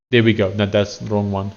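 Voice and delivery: ordinary talk, nothing marked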